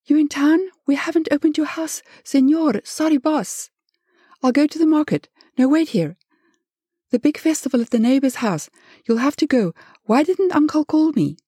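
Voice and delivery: high, rattled-off stream of fretting; flustered woman going on and on; near hysteria. A narrator's reading. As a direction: babble on in a high, agitated voice. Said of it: "close to hysteria".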